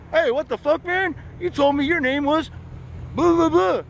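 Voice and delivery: mock the cop blowing up at him; angry cop. Cop realizing he gave a false name, high mock outrage. Second cop catching the lie.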